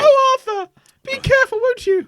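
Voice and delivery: put-on shrill falsetto old wife; high falsetto wife. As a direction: squeak it out as the worried wife. Voicing farmer's wife.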